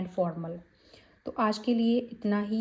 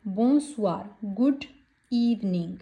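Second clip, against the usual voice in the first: ordinary talk, nothing marked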